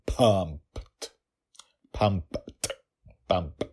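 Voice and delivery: "pumped" said alone, deliberately, with popped p and final t; careful pronunciation. Shown as a demonstration. Host checking how to say "pumped", careful pronunciation. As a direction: try out the word "pumped", each sound separate. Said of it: "That's how you say it, right?".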